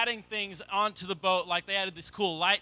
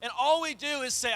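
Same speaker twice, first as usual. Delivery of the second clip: rising, louder preacher's push; preaching; 6 semitones higher, 4 dB louder than usual. Acted. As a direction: drive the point home to the congregation. Building sermon climax.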